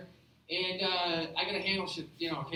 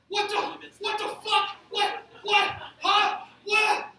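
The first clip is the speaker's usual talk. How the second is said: high-pitched, stammering outburst of disbelief; aghast, mad; 9 semitones higher, 3 dB louder, slower than usual. Acted. Manager walks in on tomato carnage, aghast and mad. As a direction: sputter in escalating shock, much higher. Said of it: "Like, he's mad now".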